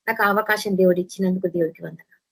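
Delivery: ordinary talk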